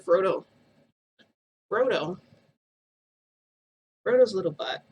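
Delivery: put-on loud urgent call of the baby's name. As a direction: call him sharply while straining. Calling baby while holding TV.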